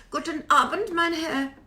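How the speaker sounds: high mock-German greeting; hammed-up German politeness; bad German accent. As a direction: put on an awful German accent. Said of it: "Don't make me talk in my German accent".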